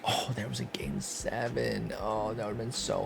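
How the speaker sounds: whispering the results under his breath; soft unvoiced whisper while reading the screen. Real, not acted. Reading playoff results to himself.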